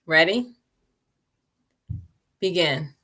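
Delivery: dropping to a hush on begin and breathe; soft start cue. Acted. Teacher softly starting the meditation minute, hushed. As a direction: ease the group into silence.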